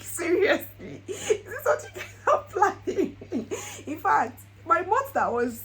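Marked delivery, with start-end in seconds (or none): 0.0-3.9 much higher, laughing through tears; choked happy laugh; stunned laughing cry; laughing tearful disbelief. Retold or imitated. Retold proposal reaction.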